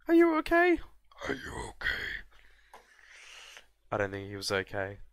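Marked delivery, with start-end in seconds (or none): 0.1-0.8 high, loud mock call to a soldier; mock concerned call. Calling to his fallen soldier, loud high mock concern. 1.3-1.9 whispered, breathy repeat; mock whisper. To the fallen soldier, a whispered mock check.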